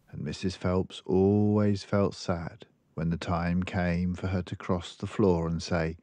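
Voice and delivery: usual speaking voice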